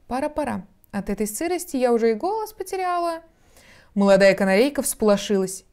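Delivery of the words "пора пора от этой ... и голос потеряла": high, thin bird voice; chattering old magpie. A narrator's reading. Old magpie excuses herself from the damp, chattering high. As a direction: fuss softly, pitch rising high. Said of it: "прострекотала".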